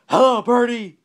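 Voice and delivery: loud, high holler; yelled greeting. A narrator's reading. Bird watcher greeting a bird in the tree, yelling. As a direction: yell hello up into a tree. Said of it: "he yelled".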